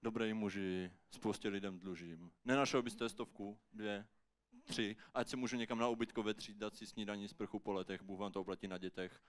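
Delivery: low, subdued plea for money; pleading beggar. Acted. Homeless man asks passer-by for money, low humble pleading. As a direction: beg humbly in a low, soft voice.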